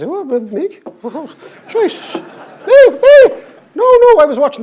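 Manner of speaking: high squealing protests; hammed-up panic. Idle economist caught out when the place crashes, mock panic. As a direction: squeal in mock panic, far above your own voice.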